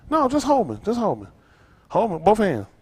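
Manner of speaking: ordinary talk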